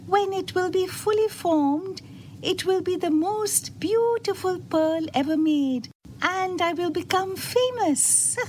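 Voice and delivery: high-pitched little oyster, bragging; boastful small oyster. A narrator's reading. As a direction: brag in a high character voice.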